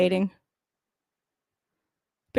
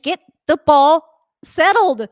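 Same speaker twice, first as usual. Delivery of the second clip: urging the team; 6 semitones higher, 7 dB louder, slower than usual. Real, not acted.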